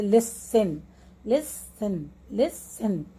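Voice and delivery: Listen split slowly, weight on लिस; teacher's two-beat demo of listen; stress on लिस. Shown as a demonstration. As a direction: lean on लिस, then let सन fall. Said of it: "तो ये लिस पे जोर है".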